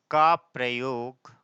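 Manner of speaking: low-key, drawn-out words with long pauses; slow, measured classroom dictation. Real, not acted. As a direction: dictate phrase by phrase for note-takers. Dictates the rule word by word.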